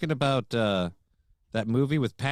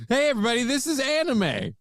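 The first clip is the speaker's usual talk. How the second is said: announcer voice; 10 semitones higher than usual. Pretend. Announcing in high voice.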